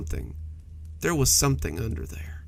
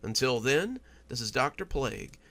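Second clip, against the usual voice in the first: slow, much softer, hushed outro; spooky host sign-off; 5 dB quieter, slower than usual. Acted. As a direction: ease listeners out with a creepy calm. Host Doctor Plague signs off.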